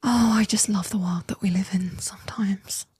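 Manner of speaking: soft, breath-led sarcastic near-whisper; sarcastic sigh. Real, not acted. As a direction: sigh it out with weary irony. Sarcastic aside on the clips.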